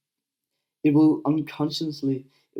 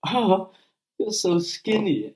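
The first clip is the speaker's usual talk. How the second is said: higher, louder, drawn-out taunt; mocking bully; 8 semitones higher, 4 dB louder, much slower than usual. Bully taunting a skinny kid, high and mocking. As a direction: sneer it out slowly like a bully teasing. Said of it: "somebody comes up to me and say".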